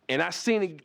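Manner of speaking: ordinary delivery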